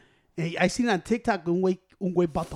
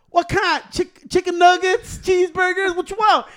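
high, loud, drawn-out cashier rattling off choices; mock cashier yell; 10 semitones higher, 7 dB louder, slower than usual. Cashier yelling menu options at customer, loud high mock yell. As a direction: belt out the menu like an impatient cashier.

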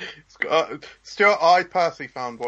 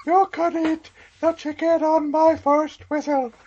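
much higher, put-on referee voice; mock referee; 3 dB louder than usual. Joining the Gladiators skit as a high comic referee. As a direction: call it like a silly Gladiators referee.

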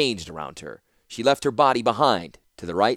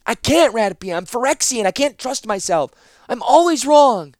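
high, loud anguished admission; despairing; 13 semitones higher, 9 dB louder than usual. Acted. Zancha refusing Ratapi in despair, high and loud. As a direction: confess it in despair.

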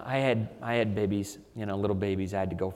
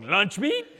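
LUNCH MEAT? sung out high, louder, rising; sing-song lunch meat; 11 semitones higher, 6 dB louder than usual. Retold or imitated. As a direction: chant the word like a jingle, way up high. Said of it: "I'm like going".

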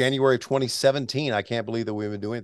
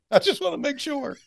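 high laughing giggle. Real, not acted. Laughing as he recalls checking with the boss. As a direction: laugh it out, relieved.

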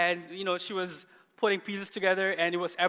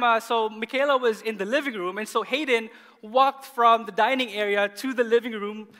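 normal talking voice

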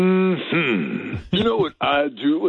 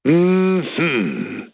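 ordinary talk, nothing marked

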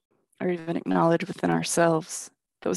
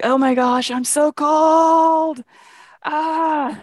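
slow, moaning whimper, higher and louder; moaning with cold; 9 semitones higher, 6 dB louder, much slower than usual. Retold or imitated. Man freezing at bus stop, moaning to Andrea. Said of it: "I heard him moaning".

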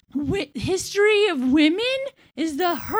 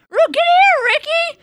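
higher, louder summons of Ricky; mock call; 7 semitones higher, 3 dB louder than usual. Lucy act calling Ricky to the stage, loud high call. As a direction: holler for Ricky to get over here.

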